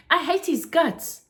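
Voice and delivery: loud, high, bitter hatred; angry. Acted. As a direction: hurl it out furiously. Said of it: "with fire in his hi-, eyes".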